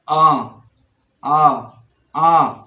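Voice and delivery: आ said through the nose; drawn-out nasal आ; आ sounded nasally, repeated. Shown as a demonstration. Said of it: "नाक से उच्चारण होना चाहिए आ".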